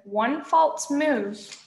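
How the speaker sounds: high, very slow warning; menacing warning. A narrator's reading. Hero backing away from the monster, slow drawn-out warning. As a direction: draw each word out as a threat.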